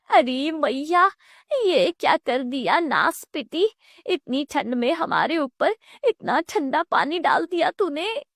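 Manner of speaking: outraged mother-in-law. Acted. Mother-in-law doused with cold water, indignant wailing complaint.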